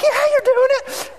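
very high, squealed cheer; eager reassurance. Retold or imitated. Dad hyping his kid on the bike, very high squeal. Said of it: "I'm like".

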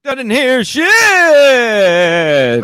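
very slow, high, drawn-out put-on voice; slow-motion mock voice. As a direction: stretch every word like a lagging stream. Mocking the guest's lag.

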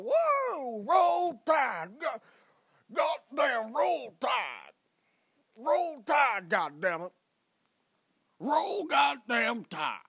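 loud, high, slow Roll Tide yell; Bama fan cheer. Retold or imitated. A stranger proudly shouting where he is from, rowdy cheer. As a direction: holler it like a rowdy Alabama fan.

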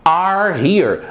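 The police are here, each word spelled out slowly; careful slow teacher model; slow model sentence. Shown as a demonstration. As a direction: dictate it slowly to learners. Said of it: "Em inglês é".

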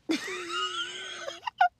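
squealing burst of laughter; high shrieking laugh. Real, not acted. Breaks up laughing.

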